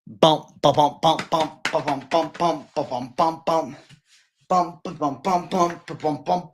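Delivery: mouth-made drum beat of bump and ba-bum; beatboxing a workout rhythm; beatboxed rhythm. Acted. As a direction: keep a steady beat with your mouth. Vocal beat for shadowboxing.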